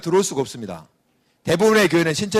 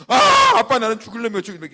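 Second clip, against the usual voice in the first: hammed-up wail, much higher and louder; exaggerated wailing; 5 semitones higher, 4 dB louder, faster than usual. Son wailing yet again at father, mock crying. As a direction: overdo the sobbing plea. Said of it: "이렇게 나오면서".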